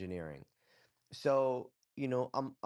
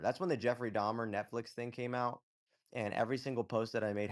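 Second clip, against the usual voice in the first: ordinary talk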